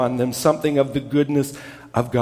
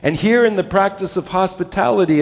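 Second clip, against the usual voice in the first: ordinary delivery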